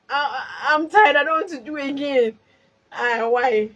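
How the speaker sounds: loud tearful wail of tired; crying out tired. Real, not acted. Vlogger crying out to viewers that she's tired. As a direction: cry out that you're tired. Cry heard, tired lament.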